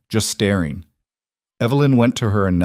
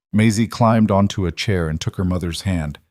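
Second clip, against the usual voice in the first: usual speaking voice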